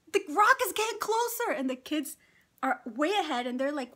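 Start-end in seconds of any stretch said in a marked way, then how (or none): 0.1-1.5 high, alarmed cry; retold fearful squeal; panicked. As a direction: warn of the rock in rising panic. Panicking in canoe.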